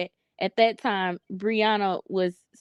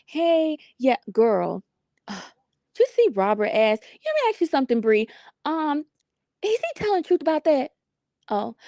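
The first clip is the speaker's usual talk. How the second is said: imitating Amora on the phone; 5 semitones higher than usual. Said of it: "here go Amora, y'all".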